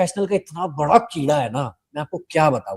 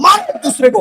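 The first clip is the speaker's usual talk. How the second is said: high, loud taunting shout at the brawlers; mock egging shout; 11 semitones higher, 4 dB louder than usual. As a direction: egg them on with a high, loud cheer. लड़ते कंडक्टरों को उकसाना. (लड़ते कंडक्टरों को उकसाती ऊँची, तेज़ मज़ाकिया ललकार)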